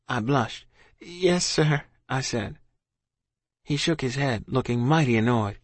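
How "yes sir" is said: high-pitched meek answer; bashful child. A narrator's reading. Blushing child to officer, high and meek. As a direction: admit it shyly, pitched up.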